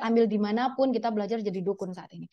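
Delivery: ordinary talk, nothing marked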